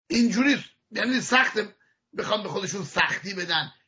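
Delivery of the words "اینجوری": ordinary talk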